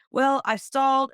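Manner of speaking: hammed-up sheepish dodge; imitating the dad. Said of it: "And he goes".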